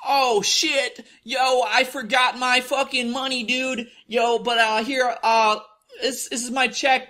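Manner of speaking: high-pitched imitation of a scamming customer; scammer's excuse. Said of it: "He comes in, and he's like".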